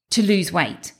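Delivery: usual speaking voice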